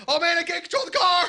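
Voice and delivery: high, loud comic panic; mock driver losing control; panicking driver.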